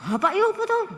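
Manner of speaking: high-pitched incredulous question; rising, scandalised mock query; mock disbelief. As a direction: ask it high, as if baffled by such manners. Mock reaction to raised voices.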